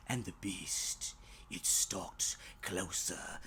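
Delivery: soft, slow whispered narration; breathy suspense. A narrator's reading. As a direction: hush as the beast creeps in. Suspense, predator approaching.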